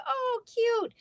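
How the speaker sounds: very high, charmed coo; delighted. Retold or imitated. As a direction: squeal it like something adorable. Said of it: "And I was like".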